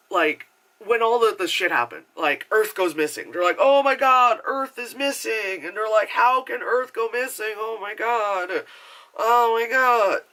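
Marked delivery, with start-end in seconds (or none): 3.6-5.6 raised-pitch, hammed-up alarm; mock panic. Spaceship crew finds Earth gone, mock panic. 6.1-10.2 higher-pitched, exaggerated disbelief; mock panic. Spaceship crew cannot grasp Earth vanishing, mock panic.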